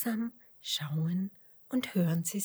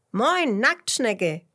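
loud, slow, higher hail; fröhlicher Gruß; 5 semitones higher, 8 dB louder, much slower than usual. A narrator's reading. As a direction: call a drawn-out hello. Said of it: "grüßt sie Fitus". (laut und gedehnt grüßend)

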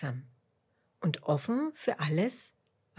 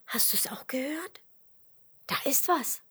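higher, hushed alert; Dotti; 8 semitones higher than usual. A narrator's reading. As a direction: point out a sound, pitched up. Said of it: "ruft Dotti". (aufgeregt, mit höherer Stimme)

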